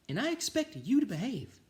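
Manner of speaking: high teacher voice. Acted. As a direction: sound like the kindly teacher announcing. Teacher voiced in read story.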